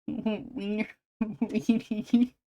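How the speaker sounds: giggling through "Wiener" and "Weenie". Real, not acted. Giggling word play.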